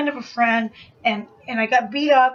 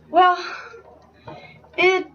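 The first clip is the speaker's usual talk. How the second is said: ordinary delivery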